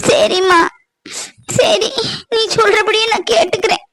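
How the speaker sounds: high, sobbing yes to her mother; tearful giving in. Acted. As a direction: agree through tears. Daughter gives in tearfully. (அழுதுகொண்டே சம்மதிக்கும் மகள்)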